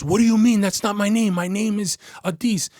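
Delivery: high, loud imitation of panicked denial; mock-frantic denial; panicked protest. Suspect's panicked denial acted out.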